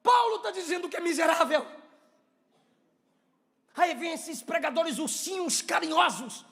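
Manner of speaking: high, shouted sermon at full force; fiery preaching. Real, not acted. Preacher humbling the church with Paul's confession, impassioned shouting. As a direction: cry it out to the congregation in long, ringing phrases.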